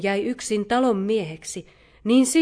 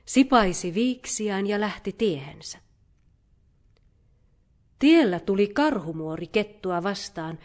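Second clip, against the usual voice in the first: ordinary delivery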